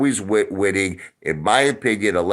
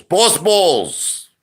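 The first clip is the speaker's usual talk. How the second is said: higher, louder, in a put-on voice; hyped-up put-on yell; 8 semitones higher, 4 dB louder than usual. Streamer hyping his chat with the catchphrase, hyped yell. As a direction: blast the catchphrase like a hype man. Repeats catchphrase, second time bigger.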